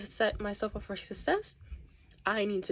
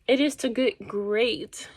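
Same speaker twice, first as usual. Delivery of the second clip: great stretched out slow and leaned on; great said long and emphatic; stress on great; 2 semitones higher, 5 dB louder, slower than usual. Shown as a demonstration. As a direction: lean on the word great, slow. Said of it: "emphasis on the word great".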